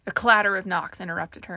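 plain reading voice

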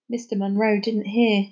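usual speaking voice

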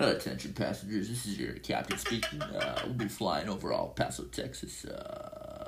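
captain's PA announcement; airport announcement. Pretend. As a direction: speak like a pilot over the intercom. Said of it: "I love it when you do".

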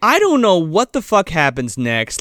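ordinary talk

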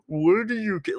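high mocking voice; mocking quote. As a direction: parrot the annoying question. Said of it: "hear one more person talk to me about".

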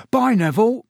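high, loud cheerful farewell; calling out. A narrator's reading. Stan calls goodbye to Harry, loud and bright. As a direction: call after him across the bar. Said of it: "called Stan".

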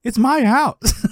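slow, drawn-out high holler; yelling dad. Retold or imitated. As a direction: imitate an indignant dad staking his claim.

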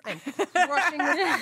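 mock triumphant shout of self-praise; triumphant boast. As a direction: crow it out like a champion. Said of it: "the whole time I was like".

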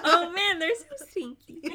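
high, giggling through the words; laughing. Real, not acted. Laughing at stinky.

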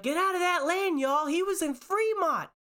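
very high frantic shout; yelling warning. Retold or imitated. Woman yelling at shoppers to leave the lane. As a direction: order people away in a panic. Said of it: "She was yelling".

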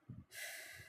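long, soft exhaled sigh; frustrated sigh. Acted. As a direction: let the breath out wearily. Character sighs in frustration.

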